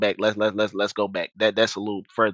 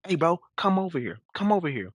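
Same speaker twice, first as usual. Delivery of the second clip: imitated calling over; 8 semitones higher than usual. Neighbor summoning people for backup, mock calling over. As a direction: mimic someone calling a friend over. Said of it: "so he's calling people".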